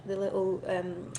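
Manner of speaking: normal talking voice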